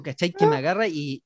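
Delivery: ordinary talk, nothing marked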